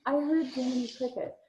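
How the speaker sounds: mildly hesitant admission; reluctant. Retold or imitated. Medium telling sitters what she heard, reluctant. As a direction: offer it up reluctantly, unsure. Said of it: "I kind of reluctantly said".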